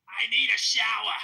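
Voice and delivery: put-on loud, high, drawn-out Boston accent. Mocking an adult keeping childhood accent, hollered Bostonian. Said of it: "just because I spoke that way at nine".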